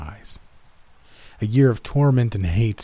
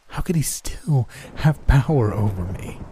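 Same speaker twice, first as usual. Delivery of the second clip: anguished whisper; 4 semitones higher than usual. A narrator's reading. Inner thought, whispered.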